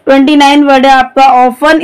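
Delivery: ordinary talk, nothing marked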